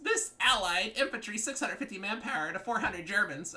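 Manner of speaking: high-pitched mocking complaint; mock whiny opponent. Player parroting rival's manpower complaint, high mock whine. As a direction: mimic a griping rival, high up.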